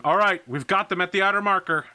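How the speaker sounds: high, loud, fast radio report; urgent comms call. Acted. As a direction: call it out quickly over battle noise. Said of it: "Rapier going".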